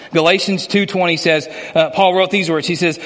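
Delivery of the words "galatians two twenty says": ordinary delivery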